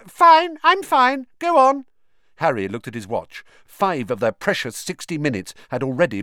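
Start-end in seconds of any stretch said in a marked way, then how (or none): 0.1-1.9 high, nervous brush-off; flustered girl. Acted. Hermione answers Harry through the door, flustered and high. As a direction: Hermione stalling from behind the door.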